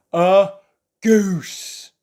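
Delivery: loud, high proud declaration; proud announcement. Acted. Mister Forgetful proudly naming the sheep a goose, loud and sure. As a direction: announce it with full confidence.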